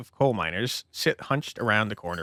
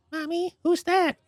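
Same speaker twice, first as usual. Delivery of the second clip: put-on very high, slow small-child voice; little child voice; slower than usual. Young child asking mommy about the stranger, very high voice. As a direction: ask like a curious toddler.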